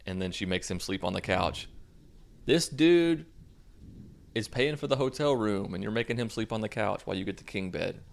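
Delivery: ordinary talk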